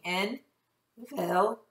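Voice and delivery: stretched out syllable by syllable; teacher sounding out each syllable slowly for pupils. Shown as a demonstration. Slow segmented sounding out. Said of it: "Did you say en".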